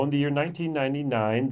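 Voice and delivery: ordinary delivery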